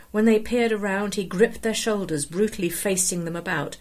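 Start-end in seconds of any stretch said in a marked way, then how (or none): none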